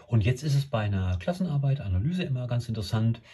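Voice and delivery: ordinary talk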